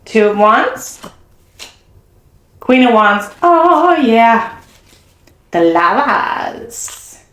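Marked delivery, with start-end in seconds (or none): 3.4-4.6 surprised. Real, not acted. Reaction to a card.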